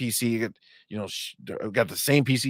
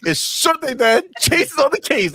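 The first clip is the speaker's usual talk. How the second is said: put-on much higher, louder little boy voice; kid voice; 5 dB louder than usual. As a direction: answer like a chirpy small son. Son's reply voiced.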